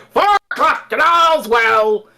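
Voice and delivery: slow, high, loud Somerset town crier call; Somerset accent town crier. Retold or imitated. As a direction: bellow it like a Somerset watchman, drawn out. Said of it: "pretends to be a random guy".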